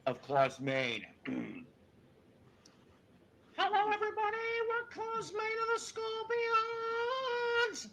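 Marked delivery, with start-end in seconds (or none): 3.6-7.8 hammed-up high metal singer impression. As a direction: greet the arena in a piercingly high singer's voice. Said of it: "Here is my impression of [name]".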